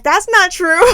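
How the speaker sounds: high, loud outraged denial; mock protest. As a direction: protest loudly through a laugh. Protesting husband's joke.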